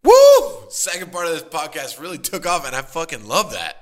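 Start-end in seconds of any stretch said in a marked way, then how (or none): 0.0-0.7 high loud cheer; loud whoop. Real, not acted. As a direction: whoop with joy. Cheering the podcast.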